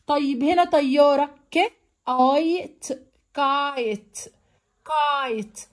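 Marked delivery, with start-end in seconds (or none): 1.5-5.6 ك، اي، ت apart, then كايت slowly blended; sounded out. Shown as a demonstration. Teacher showing kite with silent e, slow sounding-out. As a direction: stretch اي in كايت, ending on a clean t. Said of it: "بصوا حرف الاي ما نطقناهوش".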